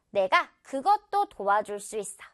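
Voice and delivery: normal talking voice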